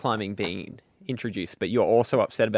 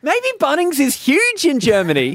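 excited; 11 semitones higher, 4 dB louder than usual. Real, not acted. Excited comic speculation.

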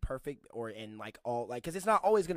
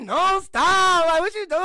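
loud, high mock protest; imitated protesting girlfriend; 15 dB louder, much slower than usual. Girlfriend resisting his playful grabbing, high loud protest. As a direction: squeal as the pestered girlfriend. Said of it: "she's like".

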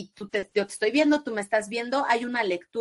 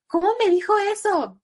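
much higher, loud shocked gasp of a question; shocked outrage; 11 semitones higher, 5 dB louder than usual. Retold or imitated. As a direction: react scandalised to blunt feedback. Reacción indignada al comentario del beso. (indignación escandalizada, aguda y fuerte)

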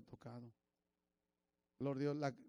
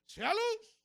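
booming, high, far louder "Sea luz"; voice of God; 7 dB louder than usual. Acted. Preacher booms God's command to the congregation. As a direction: proclaim it like God speaking creation into being.

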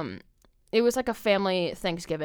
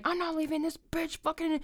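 high, softer imitation of a ranting man; mocking high-voiced tantrum; mock psychotic ranting; 9 semitones higher, 6 dB quieter than usual. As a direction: put on a high voice for his refusal. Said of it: "psychotic and just going off".

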